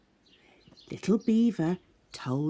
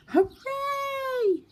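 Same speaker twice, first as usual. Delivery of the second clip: very high joyful cheer; happy shout; 4 dB louder than usual. Acted. The animal friends cheering together, very high joyful shout. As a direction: cheer all together in delight.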